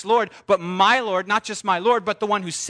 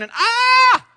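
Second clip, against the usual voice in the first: bursting cry; 7 dB louder than usual. Retold or imitated. Bursting balloon image.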